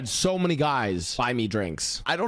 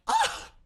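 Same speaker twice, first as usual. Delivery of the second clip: high-pitched imitated gasp; short mock-shocked squeak. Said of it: "all sh-, shocked and stunned".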